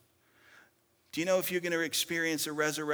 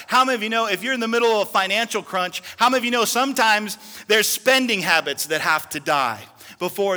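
loud, high preaching; preaching at full force; 4 semitones higher, 11 dB louder than usual. Real, not acted. Preacher exhorting the congregation on marriage and money, loud. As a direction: drive it home to the church.

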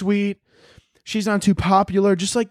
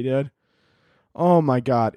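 ordinary talk

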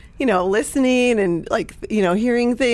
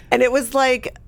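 ordinary talk

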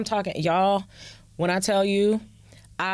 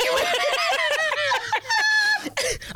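high burst of laughter. Real, not acted. Bursts out laughing mid-talk.

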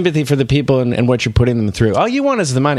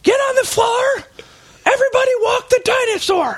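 much higher, shouted robber commands; silly high shout. Acted. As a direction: yell the orders way up high.

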